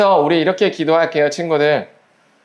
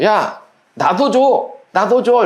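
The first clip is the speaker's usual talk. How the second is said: higher-pitched, drawn-out playful demand; demanding friend; 5 semitones higher, much slower than usual. Acted. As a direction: act out a kid calling for a share. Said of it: "친구가 이렇게 얘기해줘". (조르는 친구처럼 높게)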